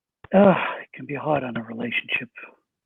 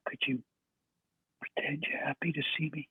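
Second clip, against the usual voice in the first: soft, breathy hush; almost a whisper, pleading gently; 9 dB quieter than usual. Real, not acted. Father's hushed, vulnerable request.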